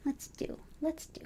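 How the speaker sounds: soft whisper. Real, not acted. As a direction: breathe it under your breath. Quiet aside.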